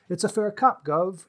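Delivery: a playful Cockney send-up; mock Cockney. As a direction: toss it off like a caught Cockney crook, mock. Reader quoting Dix giving up.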